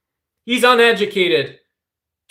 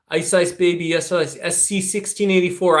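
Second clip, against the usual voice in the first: ordinary talk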